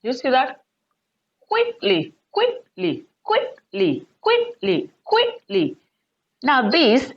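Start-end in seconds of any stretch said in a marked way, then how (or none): 1.5-5.8 QUICKly, QUICK pitched very high, ly dropping; stress on first syllable. Shown as a demonstration. Teacher drilling an adverb, QUICK in quickly stressed high. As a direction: pop QUICK in quickly up high each time. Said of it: "The stress should be on the first syllable".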